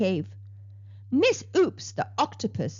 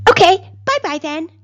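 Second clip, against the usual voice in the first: loud, high curt brush-off; a sulky shark snapping a quick goodbye; 7 semitones higher, 12 dB louder than usual. Acted. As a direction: snap it back dismissively. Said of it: "snapped Flossie".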